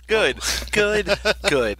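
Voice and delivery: amused, breaking into a laugh; chuckled approval between laughs. Real, not acted. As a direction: say it through laughter, delighted by the blunt verdict. Amused by co-host's blunt verdict.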